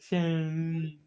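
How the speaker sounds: Seven said loud and slow, every sound clear; Seven spelled out, the s crisp and clear; clear modeling voice. Shown as a demonstration. Therapist models word for child.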